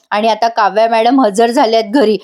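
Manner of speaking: usual speaking voice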